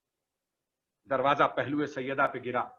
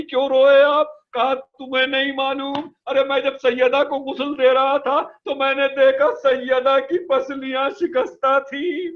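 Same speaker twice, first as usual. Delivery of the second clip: raised, impassioned majlis lament; mourning recitation; 10 semitones higher, 7 dB louder than usual. Acted. Preacher to mourners at majlis, raised grieving lament. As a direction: preach the tragedy with rising grief.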